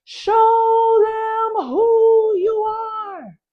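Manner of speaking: high, loud, long-drawn regal cry; Angela Bassett. Acted. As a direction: bellow it slowly like a queen urging on a fighter. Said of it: "But Angela Bassett says".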